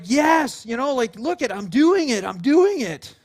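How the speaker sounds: put-on high, loud, proud little-kid cheer; thrilled child. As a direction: imitate a kid delighted with themselves. Voicing a child thrilled on bike.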